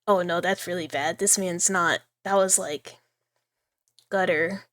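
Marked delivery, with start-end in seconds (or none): none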